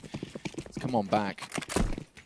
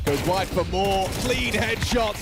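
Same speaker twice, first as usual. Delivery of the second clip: excited; 7 semitones higher, 4 dB louder, slower than usual. Real, not acted. Caster hypes a multi-kill.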